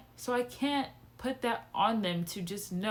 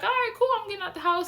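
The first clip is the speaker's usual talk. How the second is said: excited relief; 12 semitones higher, 5 dB louder, faster than usual. Retold or imitated. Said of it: "So I was like".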